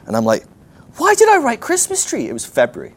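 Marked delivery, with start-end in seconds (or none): 1.0-2.3 very high, loud mock wail; exasperated self-reproach. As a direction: squeal in comic dismay at yourself. Berating himself in retold panic.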